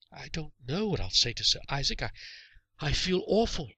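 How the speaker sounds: put-on high boy's voice, halting and contrite; remorseful boy. A narrator's reading. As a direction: confess guiltily.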